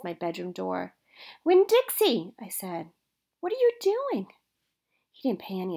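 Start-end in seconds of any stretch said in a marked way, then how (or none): 1.4-2.3 calling out. A narrator's reading. Girl calls to dog at night. 3.4-4.3 startled question. A narrator's reading. Girl startled by dog.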